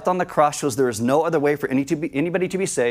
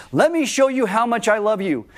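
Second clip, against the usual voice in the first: eager neighbor; 7 semitones higher than usual. Retold or imitated. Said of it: "your neighbor says".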